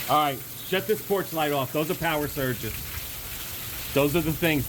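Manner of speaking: high, frantic shouting over the storm; urgent, rushed alarm as power fails; panicked. Real, not acted. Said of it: "Okay, calm down".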